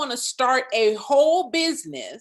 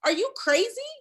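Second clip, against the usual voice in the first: incredulous, higher; 11 semitones higher than usual. Retold or imitated. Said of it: "And I'm like".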